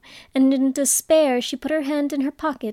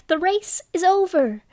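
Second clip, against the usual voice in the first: higher, calling out the result; 7 semitones higher than usual. A narrator's reading. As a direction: call it out to everyone. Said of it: "the Dodo suddenly called out".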